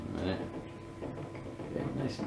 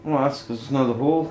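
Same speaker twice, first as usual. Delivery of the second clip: normal talking voice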